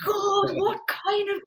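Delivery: high, loud, slow horrified cry through laughter; shocked outcry. Retold or imitated. New officer appalled by her tutor's remark, shrieking. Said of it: "I was just like".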